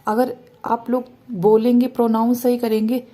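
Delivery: normal talking voice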